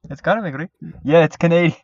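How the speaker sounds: high, loud put-on Canadian accent; laughing fake Canadian accent; mock Canadian accent. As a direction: play up a goofy Canadian. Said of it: "making Canadian accent".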